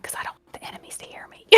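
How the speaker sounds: hushed, hurried mock whisper; very soft, voiceless playful whisper. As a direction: whisper it fast so enemies won't hear. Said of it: "I start whispering".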